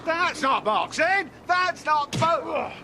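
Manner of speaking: high, drawn-out shout of protest. Real, not acted. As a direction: cry foul high and stretched out. Protesting foul play during bout.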